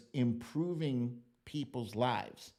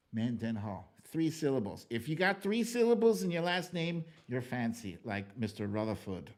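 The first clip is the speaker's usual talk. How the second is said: ordinary delivery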